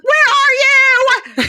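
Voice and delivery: very high, loud mock shriek; demanding yell. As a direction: imitate a girlfriend yelling at her boyfriend. Girlfriend demands his whereabouts.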